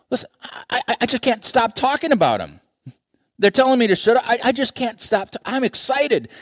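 can't hold it in, bubbling over; excited believer. Acted. A believer telling friends, bursting with excitement.

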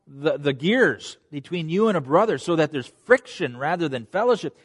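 usual speaking voice

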